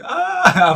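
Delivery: loud, high playful holler; answering yell. Retold or imitated. Uncle yelling along with his baby nephew, playful holler. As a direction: yell right back in imitation. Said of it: "I'm yelling with you".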